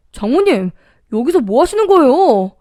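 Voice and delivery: shocked son-in-law demanding an explanation; startled shout. A narrator's reading. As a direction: call out in shocked protest. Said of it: "라고 소리쳤고".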